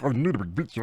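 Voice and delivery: ordinary delivery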